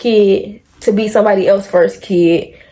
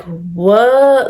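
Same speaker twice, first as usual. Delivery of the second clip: retold shock. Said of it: "I sat up and I'm like".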